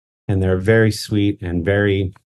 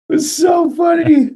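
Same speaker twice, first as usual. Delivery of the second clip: laughing shout. Real, not acted. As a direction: shriek it out through laughter. Laughing at the story.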